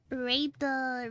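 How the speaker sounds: ordinary delivery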